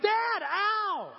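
put-on loud, high yelp of a pinched kid; imitated pained yelp. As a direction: cry out to Dad as if just pinched. Kid yelps when pinched.

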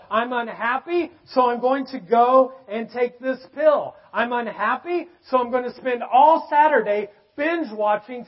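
high, loud pulpit delivery with punched key words; emphatic preaching. Acted. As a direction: preach it hard, driving each phrase home. Preaches about pleasure and happiness.

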